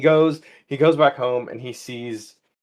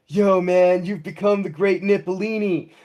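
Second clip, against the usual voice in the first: higher-pitched stoner voice; stoner capybara; 6 semitones higher than usual. Acted. Talking capybara gives the man his prophecy, stoner voice. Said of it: "can the capybara talk like a stoner?".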